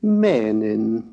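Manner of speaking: "menin" with a long first vowel, pitch rising then falling; slow, pitch-accented "menin"; circumflex accent. Shown as a demonstration. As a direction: draw out "menin" slowly with a circumflex swoop. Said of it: "emphasized with the circumflex accents".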